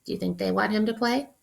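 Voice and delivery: plain reading voice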